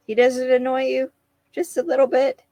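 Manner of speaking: high teasing question. Retold or imitated. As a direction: needle him a little. Teasing question about annoyance.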